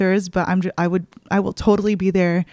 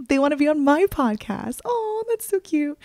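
tearful; 8 semitones higher than usual. Real, not acted. Touched by friends joining podcast.

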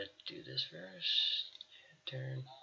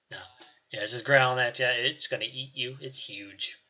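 ordinary delivery

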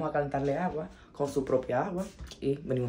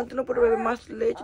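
mock scolding baby; 8 semitones higher, 6 dB louder than usual. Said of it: "Ay, Dios mío".

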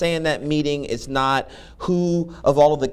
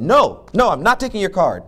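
loud, high cab driver yell; retold yelling; 5 semitones higher, 6 dB louder than usual. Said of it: "he was yelling".

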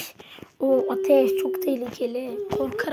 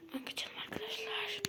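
whisper; 8 semitones higher, 16 dB quieter than usual. Real, not acted. Sneaking past camera.

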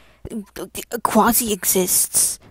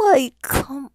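loud exasperated yell; exasperated shout; 5 semitones higher, 5 dB louder than usual. Real, not acted. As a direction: yell it in disbelief. Exasperated outburst at creator.

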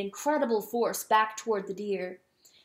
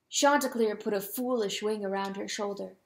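usual speaking voice

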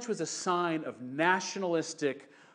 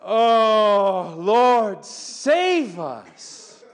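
loud pleading cry to the Lord; loud plea; 4 semitones higher, 9 dB louder, much slower than usual. Acted. Preacher crying out like the desperate crowd, loud plea. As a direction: beg to be saved, loud.

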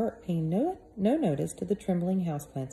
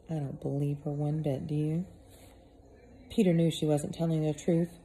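plain reading voice

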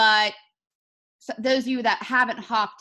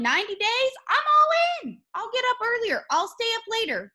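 much higher, eager and energetic; fired-up commitment; 12 semitones higher than usual. Retold or imitated. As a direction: pitch it up with excitement. Excited retold commitment.

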